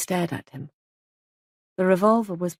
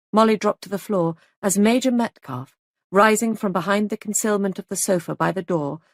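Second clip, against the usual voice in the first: usual speaking voice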